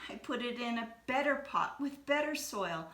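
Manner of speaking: put-on a child explaining while starting to cry, slowing on the worst part; tearful plea. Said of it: "Ping started to cry and replied".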